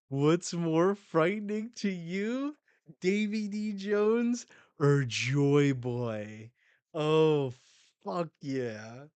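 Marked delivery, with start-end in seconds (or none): 0.1-6.5 higher, louder mocking taunt; taunting villain voice. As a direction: sneer the question at the enemy. Voicing a manga character's taunt.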